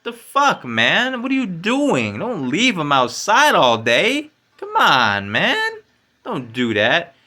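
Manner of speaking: slow, high, loud pleading rant; indignant plea. Retold or imitated. Retelling begging housemates not to leave dogs in the cold, slow high indignant plea. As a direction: drag it out, incredulous and fed up. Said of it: "I'm like".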